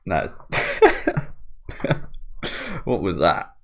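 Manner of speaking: ordinary delivery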